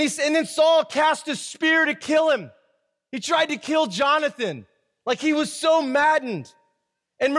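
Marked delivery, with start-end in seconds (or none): none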